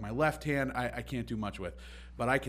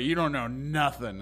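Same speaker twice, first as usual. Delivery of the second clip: mocking boss; 3 semitones higher, 5 dB louder than usual. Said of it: "he's like".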